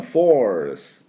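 teacher demonstrating force in an American accent. Shown as a demonstration. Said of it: "アメリカ人は".

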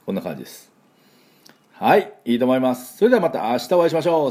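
ordinary delivery

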